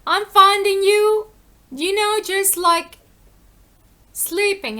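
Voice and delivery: impatient teacher chiding his students; exasperated rebuke. Retold or imitated. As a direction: scold the sleeping disciples, voice up high and loud. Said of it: "he's like".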